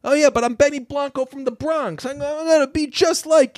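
squeaky eager fanboy, hammed up; eager young gangster. Eager young Benny Blanco voiced.

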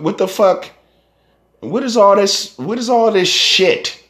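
high, disbelieving what is all this; incredulous outburst. Real, not acted. Ranting at the rap scene, high incredulous outburst. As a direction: throw up your hands in disbelief. Ranting at rappers killing each other.

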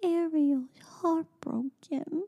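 soft, slow, breathless little sad whisper; imitating a toddler's heartbroken whisper; mock sad whisper. Mother imitating daughter's sad face whisper. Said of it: "this little sad face and goes".

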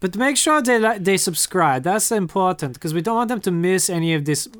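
put-on German accent; bad German accent. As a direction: say it as a comic German. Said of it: "there was a German accent".

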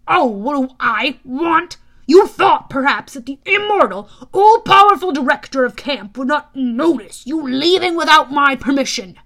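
loud, indignant camp director; irritable god, sarcastic. Acted. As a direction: scold with pompous sarcasm.

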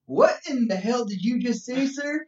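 high-pitched, drawn-out scandalized reaction; mock alarm. Reacting to the guy's dick chairs, mock horrified. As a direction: play shocked and scared for laughs. Retells shocked reply to misheard word.

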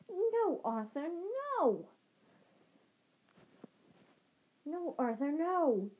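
high pleading shriek. Acted. Wife shrieking at husband to stop fighting, high. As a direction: cry out to stop him, high. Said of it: "Missus Weasley was shrieking".

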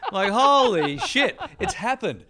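real laughter, high and loud, holy shit dragged out; laughing disbelief. Real, not acted. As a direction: burst out laughing at the realisation. Laughing at his own embarrassment.

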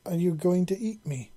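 high, timid and trembling; scared little creature asking the big stranger. A narrator's reading. Frightened hatchling asks queen if she'll eat her, trembling. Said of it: "the hatchling asked in a trembling voice".

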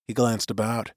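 ordinary delivery

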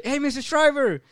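high, eager hail; calling out. Retold or imitated. Coworker hails the next actor to arrive, calling out. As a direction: imitate a fan calling to a star.

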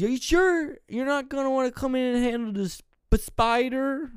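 put-on high-pitched old man voice; old man asking. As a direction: plead in a hammed-up old fellow's voice. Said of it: "he goes".

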